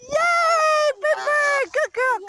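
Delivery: loud, high shout of delight; excited cheer. Real, not acted. Owner cheering as her bird reaches her, loud and high. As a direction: celebrate her arrival.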